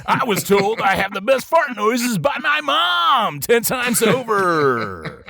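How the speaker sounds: high, hammed-up boastful yell; comic high-pitched bragging shout; mock-proud shout. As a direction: declare it shrilly, dragging out the end.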